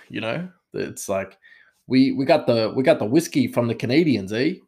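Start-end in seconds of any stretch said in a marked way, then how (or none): 1.9-4.5 put-on higher, louder Canadian accent with a tacked-on eh; mock Canadian accent. Mocking the game's characters, hammed-up Canadian accent. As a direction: ham up a Canadian game voice. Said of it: "they come across as, like, Canadian".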